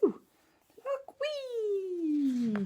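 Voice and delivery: high delighted whee for the slide; gleeful whee. Acted. Storyteller showing a possum sliding down a kangaroo, gleeful. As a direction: squeal with the fun of it.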